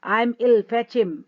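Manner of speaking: acted hysterics, shouting for him; hysterical cry. Natalia in hysterics orders her father to fetch him. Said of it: "hysterics ... चिल्लाने लगती है".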